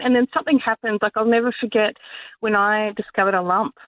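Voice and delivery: usual speaking voice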